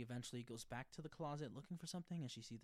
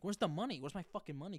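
loud, furious demand for the money; angry demand; 7 semitones higher, 8 dB louder than usual. Retold or imitated. A friend yelling about her stolen money, loud and furious. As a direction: bark it like she's been robbed.

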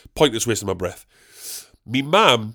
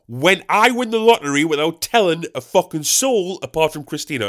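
normal talking voice

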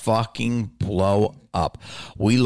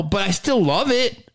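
usual speaking voice

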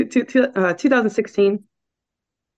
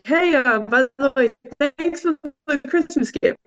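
sarcastic thanks; 6 semitones higher than usual. Retold or imitated. To her boyfriend after no proposal, sarcastic and higher.

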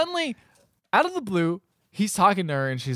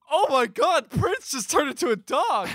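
high-pitched imitation of a shocked kid; mock shock; 9 semitones higher than usual. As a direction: play the kid's astonished outcry, pitched way up. Acting the kid's shock at transformation.

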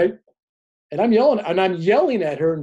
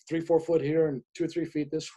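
ordinary talk

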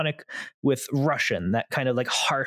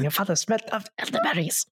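quick, hammed-up French taunt, pitched higher; mock French accent; 7 semitones higher, faster than usual. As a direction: fire off the insult in a silly French accent. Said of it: "filthy Frenchman".

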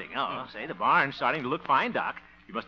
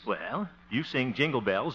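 ordinary talk

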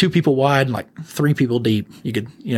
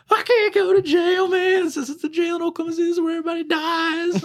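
imitating drunk crying. Drunk prisoner begging the officer not to go to jail, crying. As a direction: wail it like a scared drunk begging. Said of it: "He starts crying".

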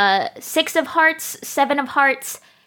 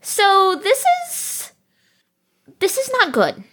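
stunned reaction to bad news in the paper; dismayed; 7 semitones higher than usual. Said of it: "we're looking at the newspaper going".

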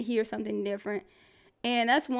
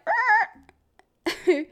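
high, strained groan of frustration; frustrated groan; 10 semitones higher, 5 dB louder than usual. Retold or imitated. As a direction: groan like you're fed up with him. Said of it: "And I'm like".

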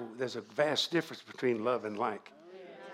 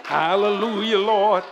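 high, shouted praise; full-force preaching; 12 dB louder than usual. Real, not acted. Preacher urging the crowd to praise the Lord, shouting high. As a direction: whip the congregation up with ringing shouts.

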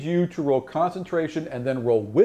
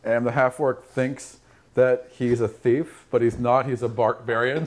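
ordinary talk

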